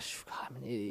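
breathy whisper, calling yourself an idiot; whispered self-reproach, embarrassed on the phone; whispered self-scolding. Retold or imitated. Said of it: "and I was like".

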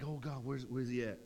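low, soft mock despondency; deflated. Believer like Elijah the next day, deflated murmur. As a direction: let it sag, quiet and beaten. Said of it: "the next day you're like Elijah".

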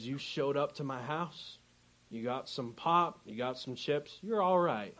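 low, soft West Virginia mountain-man voice; understated West Virginia accent, giving gruff approval. Retold or imitated. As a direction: imitate a quiet, burly West Virginia host. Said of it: "a little bit of the accent".

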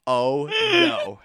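dread. Retold or imitated. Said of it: "I'm like".

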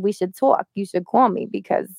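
ordinary talk, nothing marked

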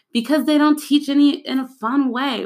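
lightly voiced grumbling pupil; complaining student. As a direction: play the fed-up kid. Student answering teacher's whys.

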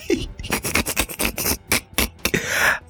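laughing at herself; breathless embarrassed giggle; high squeaky laugh. Real, not acted. Laughing at self.

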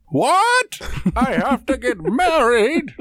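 high, booming put-on villain voice; drawn-out, high-pitched movie-villain parody; silly, slow and high mock outrage; Bane. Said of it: "here is Bane".

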